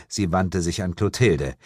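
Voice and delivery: plain reading voice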